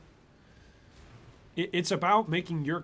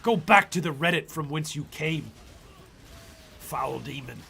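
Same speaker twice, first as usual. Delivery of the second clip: louder, grand hammed-up banishing; mock exorcism; 6 dB louder than usual. Streamer banishes a complainer back to Reddit, hammed-up exorcist. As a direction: cast them out like a priest banishing a demon.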